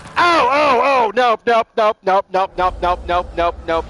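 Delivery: loud scared nope chant; backing away in real panic; panicked nope. Real, not acted. Scared by monster.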